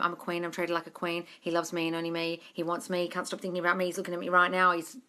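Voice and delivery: quiet, calm self-affirmations; soft affirmation voice. Shown as a demonstration. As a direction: murmur them like a mantra. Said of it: "Just affirm, affirm, affirm".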